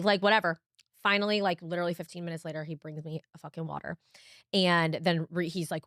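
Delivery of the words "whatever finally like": usual speaking voice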